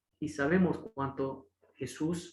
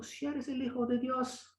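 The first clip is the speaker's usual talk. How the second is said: taunting devil; 10 semitones higher, 5 dB quieter than usual. Retold or imitated. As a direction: sneer the condition like the devil. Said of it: "que está diciendo".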